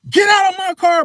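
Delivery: high, loud retold order; heated reenacted shout; yelling. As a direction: raise the voice, throwing someone out.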